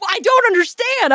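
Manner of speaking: very high, bewildered mock whine; confused pleading. Teen begs sister to explain, very high confused mock plea. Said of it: "Still didn't get it".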